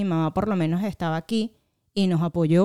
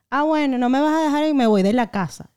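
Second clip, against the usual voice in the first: put-on higher, slightly louder defiant teen comeback; retold defiance; 6 semitones higher, 4 dB louder than usual. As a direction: act out a daughter's threat to leave. Acted defiant threat to leave home.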